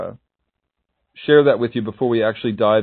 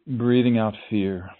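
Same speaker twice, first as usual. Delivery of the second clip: calm, unhurried meditation voice; guided meditation. Acted.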